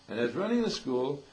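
ordinary talk, nothing marked

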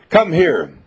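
firm commanding call; mother calling her son in a strong voice; strong, powerful. Retold or imitated. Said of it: "in a very strong, powerful way".

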